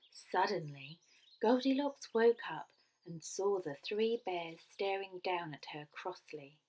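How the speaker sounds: usual speaking voice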